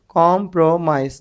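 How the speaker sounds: Compromise slowly in three beats; syllable by syllable. Shown as a demonstration. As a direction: break compromise into com, pro, mise. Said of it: "तीन है अपने".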